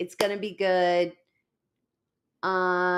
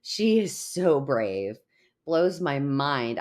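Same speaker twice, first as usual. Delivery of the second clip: ordinary delivery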